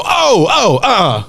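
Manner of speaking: startled shout. Retold or imitated. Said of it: "And I go".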